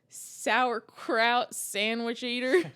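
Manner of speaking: mock Southern insult. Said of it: "What did I say?".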